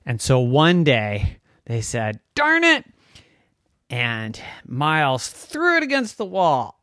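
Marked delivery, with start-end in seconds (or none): 2.4-2.8 high, loud exasperated cry; frustrated outburst. Acted. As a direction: blurt it out in acted frustration. Frustrated children throw the puzzle.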